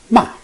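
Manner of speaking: short "Ma" falling from high; fourth tone. Shown as a demonstration. Teacher reading the four tones, fourth "Ma" falling. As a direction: drop "Ma" sharply. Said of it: "read those four tones all together".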